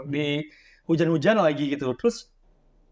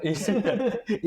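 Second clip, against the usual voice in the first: amused, higher-pitched laugh; 6 semitones higher than usual. Real, not acted. Host amused by the horror writer remark, laughing. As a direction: laugh at the guest's quip.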